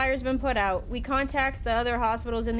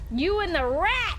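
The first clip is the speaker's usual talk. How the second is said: angry accusation; 6 semitones higher than usual. Real, not acted. Accusing a game character.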